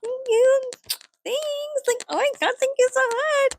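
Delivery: high, squealy gushing thank-you. Retold or imitated. Thanking the Hot Topic clerk, high squeal. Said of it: "I'm like".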